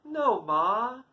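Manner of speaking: high, innocent little denial; coy child. Acted. Mud-smeared toddler denies it to mother, coy high voice. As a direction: play the toddler coyly fibbing to Ma. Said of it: "Krishna looks at her coyly".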